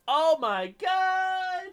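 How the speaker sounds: high, slow drawn-out cry; amused exclamation. Real, not acted. Podcaster reacting to Sarah pushing the button, surprised. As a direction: stretch it out in amused shock.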